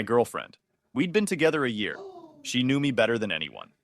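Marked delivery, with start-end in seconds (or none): none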